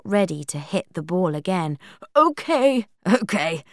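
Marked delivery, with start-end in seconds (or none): 2.2-3.6 hasty "okay" starting high and loud, then dropping; hurried, reluctant agreement; quick concession. A narrator's reading. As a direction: give in quickly, first word high. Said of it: "Giles said quickly".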